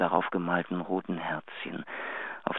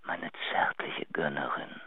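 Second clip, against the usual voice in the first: tender whisper; 4 semitones lower, 11 dB quieter, slower than usual. Acted. Intimate farewell in a love message.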